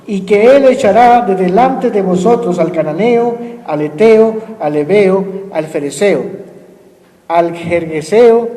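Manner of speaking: slow, measured reading of the verse; scripture reading. Acted. Pastor reading Joshua to the congregation, slow and solemn. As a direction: read the promise out slowly and solemnly. Reads scripture aloud slowly.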